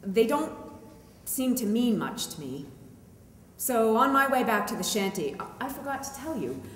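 ordinary delivery

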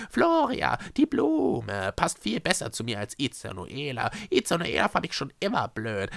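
high, gushing character voice; infatuated Schreckse. Acted. Schreckse defends her admirer to Echo, infatuated. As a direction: swoon happily over the new name.

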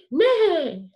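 big loud neigh, high and falling; horse neigh. Shown as a demonstration. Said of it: "make the sounds".

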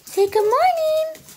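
put-on high, sing-song baby talk for the dogs; high pet talk. Owner greeting viewers with her pugs, high sing-song pet talk. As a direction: coo good morning at the pugs in a high voice.

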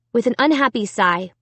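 plain reading voice

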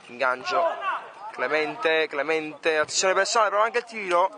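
live play-by-play commentator; sports commentary. Acted. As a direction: call the match as it happens. Match play-by-play.

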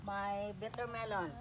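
normal talking voice

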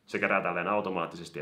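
ordinary delivery

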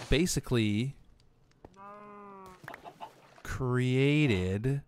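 slow, drawn-out thinking aloud; talking slowly. Real, not acted. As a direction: stretch each word out while thinking. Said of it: "I'm not sure why I'm talking so slowly".

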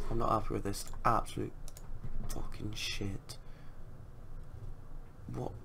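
muttering. Real, not acted. Quiet despair after conceding.

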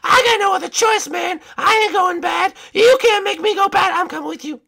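high-pitched princess giving in; high cartoon princess, reluctant agreement; resigned princess. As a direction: surrender in a high, protesting voice. Princess gives in.

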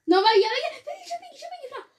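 high urgent cries; urgent warning. Real, not acted. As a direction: warn him Piggy is here. Warning, Piggy is coming.

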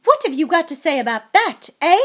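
loud, high demand; enraged wife challenging the husband; furious shout. A narrator's reading. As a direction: yell for an answer, ending on a sharp prod. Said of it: "shouted Missus Weasley".

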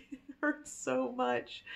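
slow, high laugh while saying it hurts; laughing through pain. Real, not acted.

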